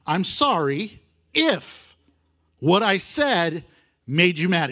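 each phrase drawn out slowly; slow, deliberate quote. Shown as a demonstration. Preacher picking apart a teen apology, slow and deliberate. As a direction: spell out the excuse slowly, phrase by phrase. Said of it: "look at the wordings".

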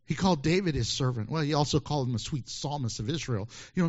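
usual speaking voice

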